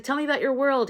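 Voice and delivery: excited curiosity. Retold or imitated. Scientist asking a new friend about his world, excited. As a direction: lightly act out eager curiosity. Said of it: "he's so excited".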